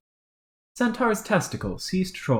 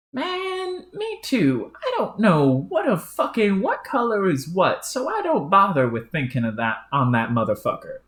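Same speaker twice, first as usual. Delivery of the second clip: higher, cocky, rough-mouthed character; brash troll; 9 semitones higher than usual. Acted. Crude troll agreeing with his stuffy partner, higher and brash. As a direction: shoot back like a crude hothead.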